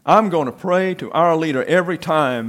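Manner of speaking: excited. Acted. Mary to the teacher over the candy, excited. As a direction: blurt it out, thrilled. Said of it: "Mary said excitedly".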